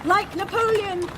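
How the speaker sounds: ordinary talk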